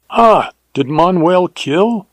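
high, slow startled question; surprised exclamation. A narrator's reading. Bandit startled to hear of the murder, surprised. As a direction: caught off guard by the news. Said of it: "exclaimed the other".

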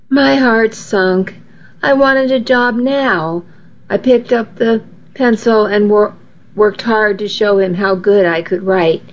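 ordinary delivery, nothing marked

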